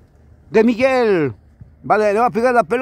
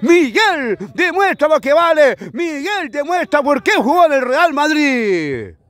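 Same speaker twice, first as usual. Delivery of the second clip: excited celebration; 5 semitones higher than usual. Real, not acted. Celebrating goal afterwards.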